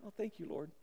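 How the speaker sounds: tiny, very soft mock thank-you; feeble thanks. Preacher mimics the meagre thanks Jesus gets, feeble and hushed. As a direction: toss it off half-heartedly, barely audible.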